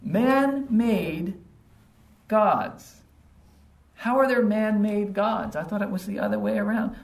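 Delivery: high-pitched, gleefully incredulous teasing; amused disbelief. Acted. Luke to Demetrius about man-made gods, amused and incredulous. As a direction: question him as if almost laughing, savouring the absurdity. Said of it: "almost laughing to himself".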